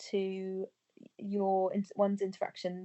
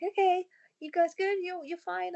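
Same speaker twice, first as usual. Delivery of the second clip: bright, high parent check-in, much higher; sing-song check-in; 10 semitones higher than usual. Retold or imitated. Mum checking in on playing kids, in a high sing-song voice. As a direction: lift the voice way up to ask the kids if they're okay.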